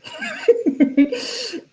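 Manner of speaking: high-pitched giggling cackle; high laugh. Real, not acted. Laughs at the couple.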